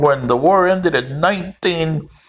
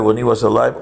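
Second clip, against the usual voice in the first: ordinary talk, nothing marked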